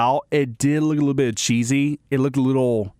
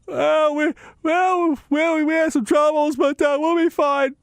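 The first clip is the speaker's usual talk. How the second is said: high, halting mock coach reassurance; Bruce Weber impression; 11 semitones higher than usual. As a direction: play the rattled coach brushing it off. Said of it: "That's my attempted impression of, uh, Bruce Weber".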